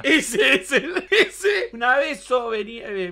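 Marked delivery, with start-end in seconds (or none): none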